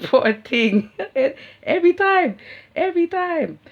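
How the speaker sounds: high, loud words through real laughter; laughing hard. Real, not acted. Laughing to viewers about the Stacy mix-up, loud and high. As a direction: crack up as you speak. Laughing at her own story.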